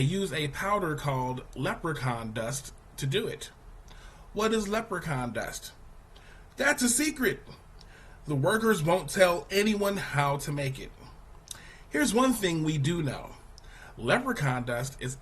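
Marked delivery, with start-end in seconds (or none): none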